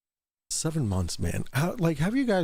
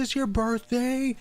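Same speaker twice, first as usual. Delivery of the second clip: excited birthday shout-out; 10 semitones higher, slower than usual. Real, not acted. Birthday call-out to viewer.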